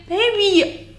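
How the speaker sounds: exasperated playful call; playful scold. Real, not acted. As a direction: call out the partner teasingly. Scolding partner's math.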